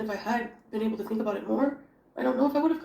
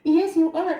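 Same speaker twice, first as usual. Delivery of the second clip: talking to a pet; 9 semitones higher, 7 dB louder than usual. Real, not acted. Cooing loudly at the cat.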